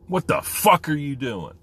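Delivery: loud, high-pitched exclamation punching "fuck"; rising inflection. Shown as a demonstration. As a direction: lean hard on "fuck", high and incredulous. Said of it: "rise and inflection to it".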